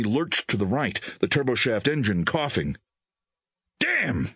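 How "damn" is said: high-pitched frustrated curse; fuming. A narrator's reading. Pilot struggling to maintain control, fuming. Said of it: "the pilot fumed".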